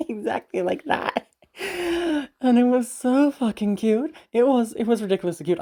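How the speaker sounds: high, giddy gush after laughing. Real, not acted. Telling viewers how cute the partner was, giddy gushing.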